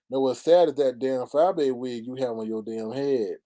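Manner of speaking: ordinary talk, nothing marked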